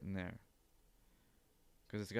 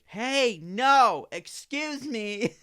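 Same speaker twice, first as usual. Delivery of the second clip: high, loud mock scolding, slow on excuse me; hammed-up prissy instructor; squeaky mock reprimand; 13 semitones higher, 10 dB louder, much slower than usual. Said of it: "the instructor like".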